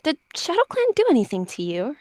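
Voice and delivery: high-pitched whining question; whiny indignation. A narrator's reading. She-cat questioning the herb-wrecker about Shadow Clan, whining. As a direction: protest in a high, hurt whine. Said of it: "it was all a whine".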